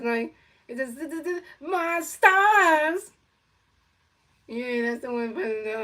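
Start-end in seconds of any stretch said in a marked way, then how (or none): 0.7-3.1 high, slow, loud stretched cartoon cat wail; Sylvester the Cat scared. Kid doing Sylvester afraid, stretched high wail. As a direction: drag out my stars like a frightened cartoon cat. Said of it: "I do the one when he's afraid".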